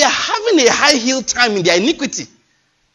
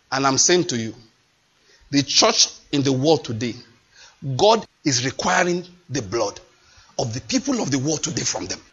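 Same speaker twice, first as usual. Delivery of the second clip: ordinary talk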